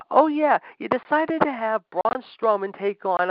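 usual speaking voice

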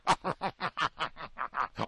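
imitated cackling of the pranksters; put-on giggling fit; mock laughter. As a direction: laugh in mockery, a long rattling run. Mimics staff laughing at prank.

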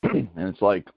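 throat clogged with peanut butter; clearing throat, choking on food. Real, not acted. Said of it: "sorry, I'm choking on peanut butter".

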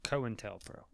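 cointelpro as KOH-in-tel-pro, "co" and "in" separate; corrected pronunciation. Shown as a demonstration. Co-host quietly correcting the host, cointelpro as KOH-in-tel-pro. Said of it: "that's not how it's pronounced".

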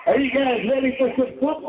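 fast, high crowd-pumping call; hype announcer. Acted. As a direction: fire up the fans. Hyping the crowd.

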